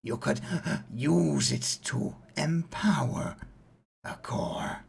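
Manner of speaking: coroner voice. Acted. GM voicing coroner NPC.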